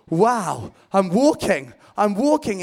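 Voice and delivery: thrilled he can walk on water; amazed delight. Acted. Peter walking on water, loud excited wonder. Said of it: "he's like".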